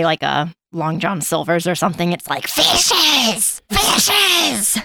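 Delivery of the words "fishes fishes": very high, rasping creature cry; Gollum. Pretend. Joking GPS passing a fish restaurant, greedy creature screech. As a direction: screech it greedily, drawing the word out. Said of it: "Anytime you drive by".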